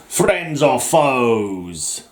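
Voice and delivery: very slow, drawn-out theatrical question; dramatic announcer. Pretend. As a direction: stretch it out like a showdown title. Dramatic title call in mock feud.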